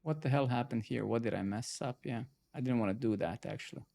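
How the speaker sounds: normal talking voice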